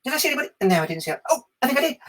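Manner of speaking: high, jittery nervous meerkat voice. Acted. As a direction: squeak it out like a skittish little meerkat. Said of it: "I think he's gonna be quite nervous like that".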